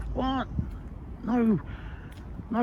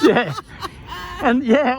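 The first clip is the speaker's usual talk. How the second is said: laughing; 6 semitones higher, slower than usual. Real, not acted. Laughs at activist label.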